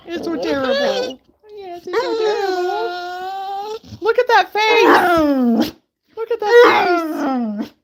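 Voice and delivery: doting pet talk. Real, not acted. Owner gushing at her dog, doting baby talk.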